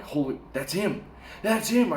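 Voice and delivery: a sudden jump up high on "That's him", half retold; spotting the wallet's owner in the crowd; excited recognition. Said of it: "like, recognition".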